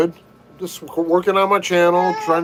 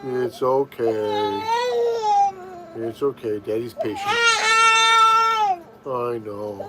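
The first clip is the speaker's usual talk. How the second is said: patient soothing; 6 semitones higher than usual. Real, not acted. Soothing his crying baby.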